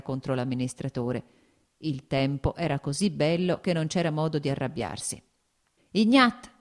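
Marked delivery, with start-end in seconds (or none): 5.9-6.5 loud, higher call across the yard. A narrator's reading. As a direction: shout for the coachman. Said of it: "gridò il cocchiere".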